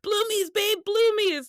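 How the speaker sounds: put-on high excited yell at boyfriend; yelling for Bloomies. Girlfriend yelling her shopping wish to boyfriend, high and eager. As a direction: squeal it out, pleading to shop. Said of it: "I just start yelling".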